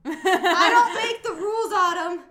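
high, loud laughing defence; laughing protest. Real, not acted. Answering Autumn's chowder question, laughing loudly. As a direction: crack up while shrugging it off.

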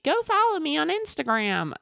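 mocking lazy plug. Mocking a weak call to action.

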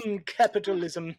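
smug rich villain purr, slowed; monocle villain. Retold or imitated. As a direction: savor it like a monocled tycoon. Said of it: "adjust our monocle and you go".

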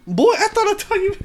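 hammed-up mom telling him off; scolding mom. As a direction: shriek it like an angry mother. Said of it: "His mom is like".

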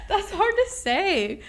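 high, loud, drawn-out laughing; helpless laughing complaint; laughing protest. Real, not acted. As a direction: squeal it through real laughter. Cracking up after botching the phrase.

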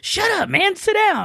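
high, playful scold; teasing shut up. Retold or imitated. Uncle playfully telling his nephew to sit down, high. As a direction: brush the kid off, joking.

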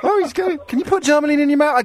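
high, fast amused disbelief; incredulous and tickled; surprised. Real, not acted. Host reacts to a caller's odd answer, amused disbelief.

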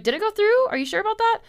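high, loud incredulous double-check; mock disbelief. She replays asking about the purchase, mock disbelieving. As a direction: pretend to doubt the payment went through.

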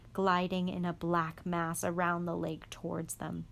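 ordinary delivery, nothing marked

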